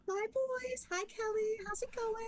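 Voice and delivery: high sing-song mom greeting; sweet mom greeting. Retold or imitated. Mom greeting her son's friends, high and sing-song. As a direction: greet them like a cheerful mother.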